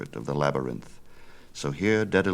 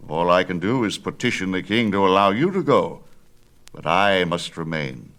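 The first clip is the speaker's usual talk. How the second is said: put-on louder, firm old craftsman, fearful of the king; insist, louder, that he must stay; old Daedalus; 2 semitones higher, 6 dB louder than usual. Father explains to son why he must stay, louder.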